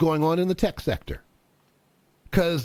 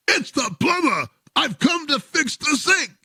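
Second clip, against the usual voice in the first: frustrated plumber; 7 semitones higher than usual. Acted.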